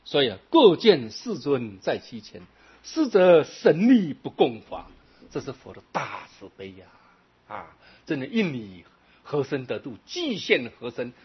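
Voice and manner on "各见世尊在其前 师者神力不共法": sutra recitation. Acted. Quoting sutra verse in sermon.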